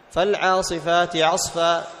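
slow, measured recitation of the verse; slow tajweed reading, held vowels; Quran recitation. Shown as a demonstration. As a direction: recite the verse deliberately, as in class. Recites verses to test pauses.

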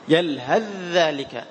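يلهث ذلك drawn out slowly, ث swallowed into a doubled ذ; full idgham demo. Shown as a demonstration. Teacher repeats the merged يلهث ذلك slowly for the class. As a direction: go straight from يلهث into the ذال of ذلك. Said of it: "تدخل مباشرة في الذال".

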